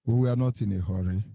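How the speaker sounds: usual speaking voice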